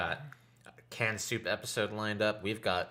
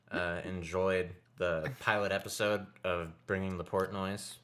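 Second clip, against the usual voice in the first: ordinary talk, nothing marked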